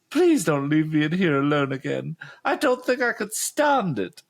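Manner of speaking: high, frightened little whine; whiny voice. Acted. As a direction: plead like a scared girl. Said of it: "her own frightened little whiny voice".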